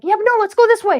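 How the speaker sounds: high, loud, bossy push; playful insistence. Acted. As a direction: pull your friend the other way. Current arguing direction.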